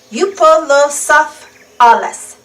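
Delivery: Euoplocephalus spelled out slowly, every syllable clear; slow it down so kids can copy Euoplocephalus; slow syllable-by-syllable name. Shown as a demonstration. Reading to children, sounding out Euoplocephalus slowly. Repeating a hard dinosaur name.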